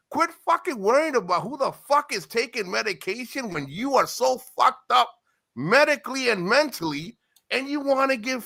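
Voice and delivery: high, heated telling-off; angry rant. Real, not acted. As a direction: lay into him with rising pitch. Heated rant at older man.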